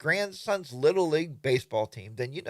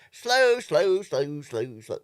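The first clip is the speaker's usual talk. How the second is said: first slow much higher and louder, then fading; mock grumble; 3 semitones higher, 7 dB louder, much slower than usual. Fed-up fast rider, mocking repeated grumble. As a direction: drag out the complaint mockingly.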